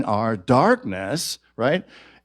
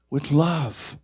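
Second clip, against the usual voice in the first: normal talking voice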